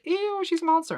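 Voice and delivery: high-pitched mock disgust. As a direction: recoil with a high, acted "ew". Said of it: "People like".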